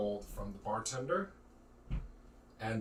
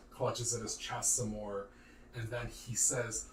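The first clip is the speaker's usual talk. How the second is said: ordinary delivery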